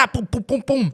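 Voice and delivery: loud, high poum beats; beatbox drum. Shown as a demonstration. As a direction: punch out the drum hits. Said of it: "imitating the drum". (batterie imitée à voix haute)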